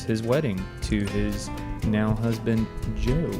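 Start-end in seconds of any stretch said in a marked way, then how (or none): none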